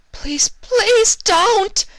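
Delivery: very high, loud slow desperate plea; frantic cry; begging. A narrator's reading. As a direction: beg for mercy. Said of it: "Big Break cried, obviously begging".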